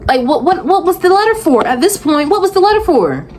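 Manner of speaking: high-pitched, baffled demand; incredulous, insistent asking; exasperated questioning. Real, not acted. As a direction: press the question in disbelief, pitch climbing. Exasperated questioning about the letter.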